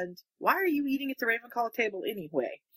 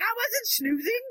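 very high-pitched protest; mock indignation; 13 semitones higher than usual. She answers his snooze-you-lose jibe, mock indignant. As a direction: squeal it as an imitated indignant retort.

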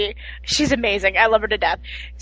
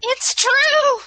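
swooning; 11 semitones higher than usual. Real, not acted. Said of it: "Listen to her".

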